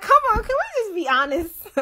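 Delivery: high squeaky retold plea; laughing exasperated plea. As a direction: plead it high, near bursting into laughter.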